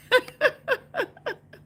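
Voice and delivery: high squealing laugh. Real, not acted. Laughing at teasing David, high squeal. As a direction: burst into a high giggle.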